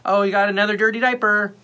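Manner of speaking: high sing-song parent voice; acted-out talk to an infant; baby talk. Talking to the baby, retold.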